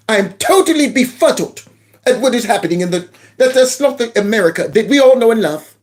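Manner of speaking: ordinary talk, nothing marked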